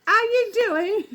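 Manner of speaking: loud, very high exaggerated British greeting; over-the-top high-pitched British accent; crazy accent. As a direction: call out in a hammed-up British accent. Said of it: "get this crazy accent person".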